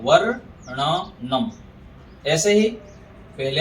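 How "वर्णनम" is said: वर्णनम very slow, "varr... na... nam"; रेफ sounded first. Shown as a demonstration. Teacher explains रेफ comes first in वर्णनम, slow demo. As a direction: trill र early in वर्णनम, then separate the rest. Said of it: "उसको पहले उच्चारण कर लेते हैं".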